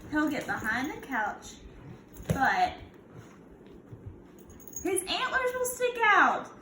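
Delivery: put-on storybook read-aloud for kids; a gentle teacher reading to her class; reading to children. Teacher reads picture book aloud.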